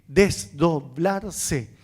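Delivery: "Desdoblarse" drawn out syllable by syllable; slow, measured "Desdoblarse" for the key term; deliberate emphasis. Real, not acted. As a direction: say "Desdoblarse" very slowly, each syllable separate. Lecturer stresses key term.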